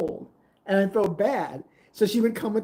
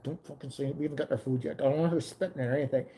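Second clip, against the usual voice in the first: low, hushed warning; quiet urgent retold warning; urgent undertone; 3 semitones lower, 7 dB quieter, faster than usual. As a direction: hiss it so the waitress won't hear. Telling Mickey quietly to stop.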